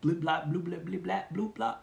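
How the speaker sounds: made-up sounds to show that words alone carry nothing; nonsense syllables. Shown as a demonstration. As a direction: babble meaningless syllables, rising in pitch. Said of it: "I could say".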